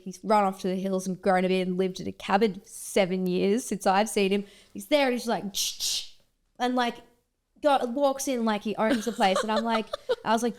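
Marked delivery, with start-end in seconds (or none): 5.5-6.1 soft, voiceless "shh" effect; imitated shushing noise. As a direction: make a quick whooshed shh sound.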